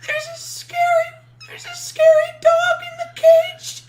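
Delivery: put-on very high, loud, whining scared child; a put-on tearful kid pleading loudly for help; little kid's voice. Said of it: "in the little kid's voice".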